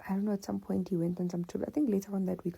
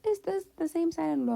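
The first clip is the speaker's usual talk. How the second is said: high, loud astonished question; a mother who can't believe it; imitating mom's loud disbelief; shocked mom; 11 semitones higher, 5 dB louder than usual. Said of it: "My mom's like".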